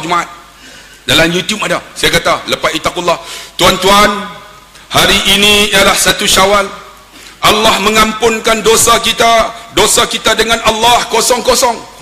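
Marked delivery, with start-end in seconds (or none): 3.6-12.0 loud, raised sermon voice; high, forceful preaching from the pulpit; khutbah delivery. Retold or imitated. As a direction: proclaim it to the whole mosque. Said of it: "pernah saya baca khutbah".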